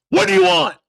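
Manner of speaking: loud, high furious bark at the door; angry bark. Retold or imitated. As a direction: bellow it like an angry giant at the door. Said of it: "mad as a wet hornet".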